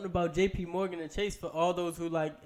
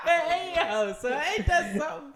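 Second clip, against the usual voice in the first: high, loud outburst through laughter; laughing; 9 semitones higher, 5 dB louder than usual. Real, not acted. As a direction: crack up at your own slip. Cracks up after losing thought.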